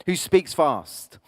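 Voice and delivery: rushed, fast delivery; speaking fast. Shown as a demonstration. Coach showing the audience how fast talkers sound, fast. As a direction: rattle it off like someone who won't let you speak. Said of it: "if I was to speak really, really fast like this".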